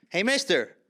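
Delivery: put-on Irish accent, calling someone over. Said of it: "An Irish accent".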